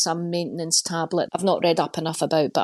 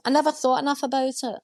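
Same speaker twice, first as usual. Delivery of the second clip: higher take; 7 semitones higher, faster than usual. Shown as a demonstration. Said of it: "repeated take".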